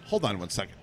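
low, quiet determined; gruff chef. Retold or imitated. Chef getting up to check claim, low quiet. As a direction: set off to prove it, low. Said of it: "He goes".

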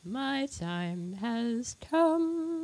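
slow dramatic villain line; villain gloat. Acted. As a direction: savor it like a supervillain. Christmas-loving host claims season.

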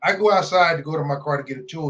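ordinary talk, nothing marked

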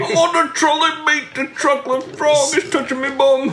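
very high, silly British squeal; hammed-up Brit at the top of the range; mock British accent. Riffing on Harry Potter for friends, high mock British accent.

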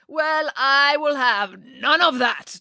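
a showy photographer holding court, unhurried; Boris voiced.

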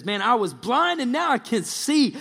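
loud, high-pitched joyful testimony; all excited. Acted. Healed man tells the Pharisees his story, excited. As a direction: blurt it out as the overjoyed healed man. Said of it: "And he's all excited".

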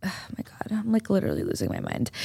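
very soft, sighing aside to herself; exasperated aside. Real, not acted. Host losing the word mid-story, soft exasperated aside. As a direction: drop far down in volume, sighing at yourself.

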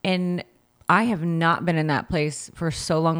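normal talking voice

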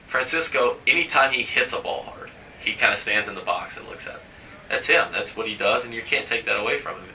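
ordinary delivery

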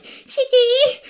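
very high squeaky cheer; squeaky anime girl. Retold or imitated. Cohost mocking anime girls, very high and squeaky. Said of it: "the little fucking squeaky voice".

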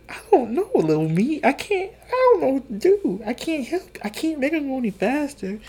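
high-pitched, pleading protest; exasperated helplessness. Retold or imitated. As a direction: plead that it's out of your hands. Acted helpless reply to irritated friend.

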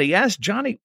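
usual speaking voice

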